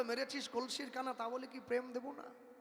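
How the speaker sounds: soft and tender, forgiving the blow; tender forgiving quote. Retold or imitated. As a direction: say it softly, full of love. Mahaprabhu forgives the man who struck him.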